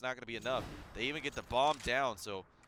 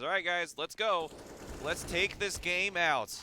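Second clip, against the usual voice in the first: excited team callout; 6 semitones higher, 5 dB louder, slower than usual. Retold or imitated. Caster voices player's callout.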